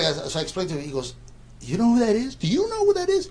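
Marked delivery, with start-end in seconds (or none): 1.6-3.3 high, insistent, imitating disbelief; incredulous excitement. Excited roommate quizzes clueless friend, imitated incredulous excitement. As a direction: build higher on the repeated question, mock-astonished. Roommate astonished at his ignorance.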